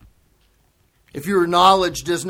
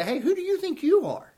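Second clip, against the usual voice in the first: much higher, put-on offended voice; indignant comeback; 4 semitones higher than usual. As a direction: bristle at someone's presumption. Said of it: "address you in a certain way and you say".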